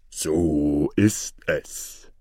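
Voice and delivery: low, very slow giant voice. Acted. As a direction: stretch each word out low as a giant. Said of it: "antwortete der Riese". (tiefe, sehr gedehnte Riesenstimme)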